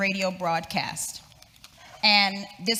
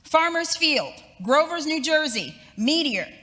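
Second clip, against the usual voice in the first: higher and louder urgent radio announcement; urgent announcer; 4 semitones higher, 4 dB louder than usual. Retold or imitated. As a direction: announce breaking news with rising alarm. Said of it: "And they said".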